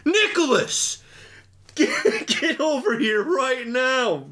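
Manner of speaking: high-pitched mock scream of a name; screaming teacher. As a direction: shriek it like a furious teacher. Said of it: "screams his name".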